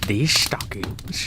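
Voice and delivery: low, slow, savoring villain voice; grinning Grinch. Acted. Grinch eyeing the Who stockings, slow low grin. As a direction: relish each word with a grin. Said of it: "he grinned".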